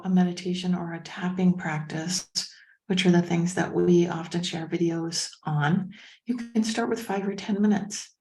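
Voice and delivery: normal talking voice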